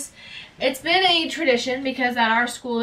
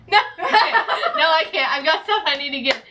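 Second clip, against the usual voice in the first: backing down from her own joke, giggling; laughing denial; 4 semitones higher, 3 dB louder than usual. Real, not acted. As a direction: laugh the idea away.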